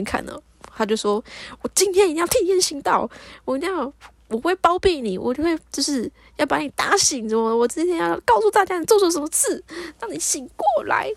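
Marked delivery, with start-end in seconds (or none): 1.6-11.1 high, mildly indignant mock scolding; retold motherly telling-off; angry mother. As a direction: act out a domineering mom, pitched up. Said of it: "然后我妈就很生气的说".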